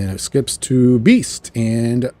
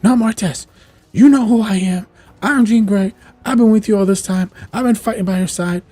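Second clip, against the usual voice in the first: loud, high indignant protest; imitated outraged outburst; acted flipping out; 12 semitones higher, 6 dB louder than usual. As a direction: insist at the top of a raised voice. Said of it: "She's flipping out".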